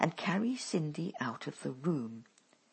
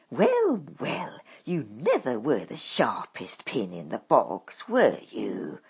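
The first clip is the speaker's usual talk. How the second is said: sneering mother, a touch louder; scornful; 4 semitones lower, 5 dB louder than usual. A narrator's reading. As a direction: drip contempt on her daughter. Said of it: "her voice laden with scorn".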